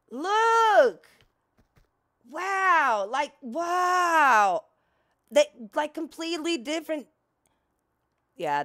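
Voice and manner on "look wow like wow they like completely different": excited. Real, not acted. Awed by the new level.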